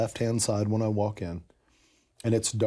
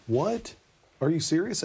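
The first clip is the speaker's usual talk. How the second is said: high, startled What? rising up; retold disbelief; 7 semitones higher than usual. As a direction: replay the shock of being invited. Retold shock at friend's invite.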